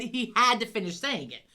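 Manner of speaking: ordinary delivery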